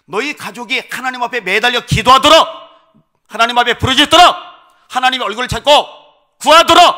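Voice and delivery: loud, high proclamation; God calling the family to pray, booming; booming divine voice. Acted. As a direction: thunder it out like God speaking. God's voice speaking to father.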